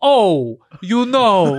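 loud high dim-witted drawl; dopey mumble imitation. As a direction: play him as slow-witted at the podium. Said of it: "could you imagine if that's how he accepts awards".

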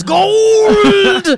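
high, loud, drawn-out Irish brogue; exaggerated Irish lilt, loud and high; mock Irish accent. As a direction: stretch it out as a hammy Irishman.

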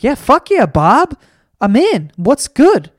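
loud and excited, all in; eager yes. Retold or imitated. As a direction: jump at the invite like a pumped friend. Eager guest accepting invite.

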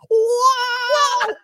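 high shouted cartoon plumber "Wow"; loud high Mario yelp. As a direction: yelp it like Mario falling off a cliff. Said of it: "Whenever I'm doing a Mario impression".

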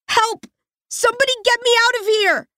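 shrieking to be let out; panicked scream. Acted. Realizes she's in a coffin.

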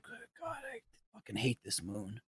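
hushed, low grumble of defeat; muttered despair. Retold or imitated. Fed-up dad muttering about the moon, hushed and low. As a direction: grumble it under your breath. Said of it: "I'm like".

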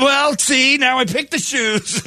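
mock fussy Renaissance guy; prim explainer. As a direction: correct her primly. Acted date reply.

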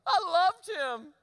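high, slow wailing lament; high drawn-out mock wail; mock sobbing girl. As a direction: ham up a heartbroken teen crying. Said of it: "And she says".

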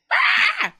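high-pitched exasperated yell; parent blowing up. Acted. Parent snapping back at a kid, mock scream. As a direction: blow up with a sudden high scream. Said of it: "when you respond by being like".

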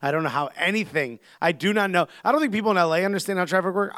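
usual speaking voice

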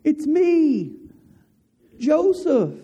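high, tender reveal, voice lifted well up; Joseph revealing himself. Retold or imitated. Joseph tells his brothers who he is, high and emotional. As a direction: let it break open like a long-held secret. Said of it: "And then he says".

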